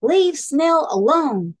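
slow, loud, high-pitched command; firm demand. A narrator's reading. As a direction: stand up to a bully, word by word. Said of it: "she demanded".

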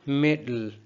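मिडिल with the D released into L; L before D finishes. Shown as a demonstration. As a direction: hold the tongue up from D into L in मिडिल. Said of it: "डी को ख़त्म करने से पहले". (मिडिल में डी पूरा किए बिना एल)

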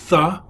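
"th" said as a lone letter sound; dotless letter sound. Shown as a demonstration. Teacher showing the class the dotless letter, "th" sounded alone. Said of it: "without the dot would be".

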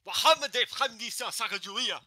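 high-pitched yell in mock Arabic; distant Arabic yelling. Voice actor performing distant Arabic yelling for a recording. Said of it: "in the distance Arabic yelling".

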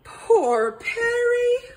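high, slow, loud wail; wailed lament. A narrator's reading. Detective lamenting fallen Perry, wailing. Owl laments possum fainting again.

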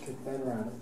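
ordinary talk

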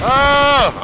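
sudden high shout of alarm; startled yell. Real, not acted. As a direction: cry out when hit. Shot while looting.

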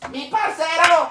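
high, hammed-up call to a buddy; crazy shouting teacher. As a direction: imitate a deranged religion teacher crying out. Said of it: "y ella gritaba".

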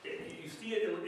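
ordinary talk, nothing marked

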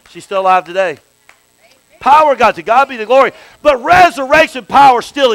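full force preaching. Acted. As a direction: preach it out to the congregation. Preaching the healing story.

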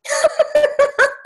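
high, loud burst of laughter; real amused laugh. Real, not acted. Laughing at being called the tech guru, amused laugh.

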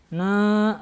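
"Н" as a wrongly nasal naa; faulty humming, nasal "Н"; through the nose. Shown as a demonstration. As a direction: push the "Н" syllable through the nose. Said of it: "Так через нос не читайте".

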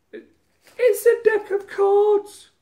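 very high, loud, drawn-out Scouse shriek; mock Scouse accent. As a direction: yell it slowly in a squealing put-on Scouse accent. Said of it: "Scouse sort of accent up thingy".

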